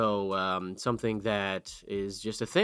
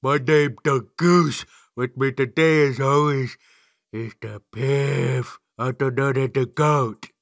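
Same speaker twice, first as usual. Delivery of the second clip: louder, slow hype-man intro; hype announcer; 3 semitones higher, 8 dB louder, slower than usual. Acted. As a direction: stretch out each name like a ring announcer. Hyped podcast intro.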